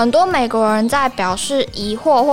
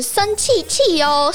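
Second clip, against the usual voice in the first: cute voice; 5 semitones higher, slower than usual. Shown as a demonstration. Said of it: "听起来不觉得很可爱吗".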